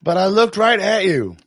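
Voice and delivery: mock prosecutor. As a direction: call him out in court. Acted cross-examination.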